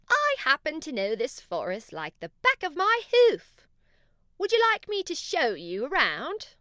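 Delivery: proud guide, showing off his forest; unicorn voice. Acted. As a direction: unicorn offering the bunnies a tour, loud and high. Unicorn offers to guide them.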